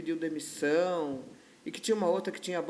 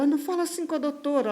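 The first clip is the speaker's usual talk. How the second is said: protesting cleaner; 8 semitones higher, 5 dB louder, faster than usual. Retold or imitated. Cleaner intervenes in the quarrel.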